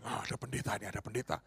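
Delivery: gossipy whisper; whispered warning. Retold or imitated. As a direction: hush the group, the pastor is here. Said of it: "Ngomongnya begini".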